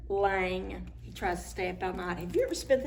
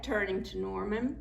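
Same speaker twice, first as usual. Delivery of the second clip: plain reading voice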